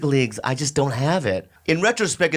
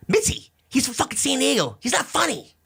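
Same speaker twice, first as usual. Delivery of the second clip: high, scornful impression of a heckling comic; lampooning Rogan's put-down in a high mock voice; mocking Joe Rogan; 10 semitones higher than usual. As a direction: sneer it high and dismissive. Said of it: "And Joe Rogan says".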